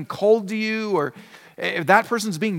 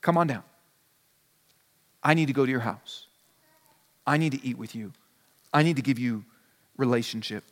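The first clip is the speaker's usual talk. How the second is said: gentle Jesus; 8 semitones lower, 5 dB quieter, faster than usual. Retold or imitated. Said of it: "he says".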